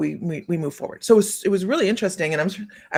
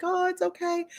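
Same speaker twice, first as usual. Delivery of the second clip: put-on high, sweet child's voice; a hint of a giggly little girl; 12 semitones higher than usual. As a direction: lightly voice a small girl reassuring.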